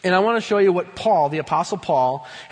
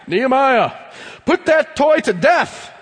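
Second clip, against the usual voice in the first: higher, louder, slow, over-the-top stern; mock-serious command; 8 semitones higher, 6 dB louder than usual. Dad pretending to scold his son over a toy, hammed-up stern. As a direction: boom it at the child like a drill sergeant. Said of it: "I've ever been this serious".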